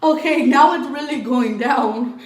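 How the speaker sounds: squealing high and loud with a laugh, hamming up the drama; mock dramatic exclaim. Real, not acted. Telling viewers the story is heating up, high, loud and laughing. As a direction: burst out high and loud, laughing at how big this got.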